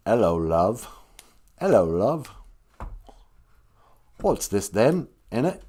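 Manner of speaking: a casual attempt at a working English accent. Shown as a demonstration. Comedian trying out English accents, casual English accent. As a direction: put on a vague English accent. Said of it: "isolating my English accents".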